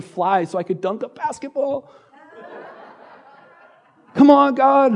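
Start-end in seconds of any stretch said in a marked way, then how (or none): 4.1-5.0 high, pleading call to God. Acted. Preacher calling out to God in mock protest, pitched high. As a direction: throw it up to God, half-joking.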